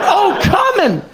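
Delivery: high ecstatic cry; mock climactic shout; exclaimed outcry. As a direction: cry out "come in" high, falling at the end. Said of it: "I said".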